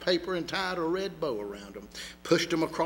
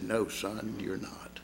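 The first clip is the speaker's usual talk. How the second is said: much softer and lower, fading to breath; hushed, near breaking; 5 semitones lower, 7 dB quieter, slower than usual. A narrator's reading. Doctor quietly confirms the boy is dying, hushed. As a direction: let it sink to a hush.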